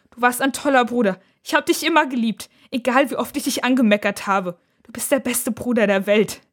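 high, impassioned outpouring; crying out. A narrator's reading. Sister hugs brother, fearing disaster, crying out. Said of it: "Nora schreit auf".

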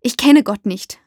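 slightly irritated. A narrator's reading. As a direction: answer with a touch of irritation. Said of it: "antworte ich leicht gereizt". (leicht gereizt)

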